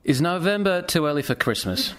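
normal talking voice

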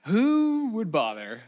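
a Greek mind scoffing, slow and loud; mock incredulous. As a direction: scoff at the idea, slowly. Voicing Greek reaction.

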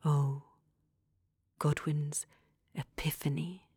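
hushed, breathy reading; a low whispered confession. A narrator's reading. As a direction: lean in and whisper the secret. Hushed narration of a revelation.

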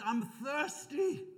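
high, slow cry from the cross; Jesus. Acted. Preacher voices the dying man on the cross, high plea. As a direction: stretch it out as a parched plea. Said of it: "he's on the cross and he says".

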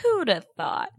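high-pitched sarcastic wonder; mock amazement. Co-host plays along with the joke, mock amazement. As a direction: act astonished by the obvious.

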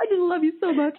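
high, light imitation of a weepy man; mock tearful husband. Wife imitating her tearful husband, high and mocking. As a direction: lightly mimic his gushing.

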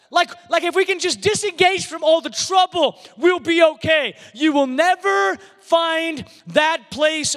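high, ringing sermon delivery; impassioned preaching. Real, not acted. Preacher telling the congregation where peace is found, impassioned. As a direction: drive each phrase home to the congregation.

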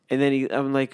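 ordinary talk, nothing marked

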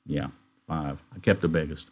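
low, soft ranch-hand voice; laconic Slim. Acted. Slim answering about the pups, low and quiet.

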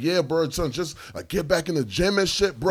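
high-pitched, urgent pep talk; imitating an excited stranger; over-invested stranger. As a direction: urge him on eagerly. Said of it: "he was so invested".

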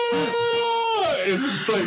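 high, stretched mock sob; mock wail. Comedian sobbing in the shower, mocked with a high wail. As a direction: wail it out like a melodramatic cry.

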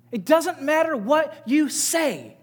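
loud, pressing sermon voice; fiery preaching. Acted. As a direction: preach it hard to the congregation. Preaching, slowed refrain.

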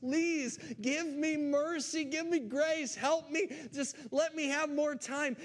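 imagined borrower begging the bank for mercy. Pretend. Debtor begging bank manager, mock-desperate plea. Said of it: "you would beg as much as you possibly can".

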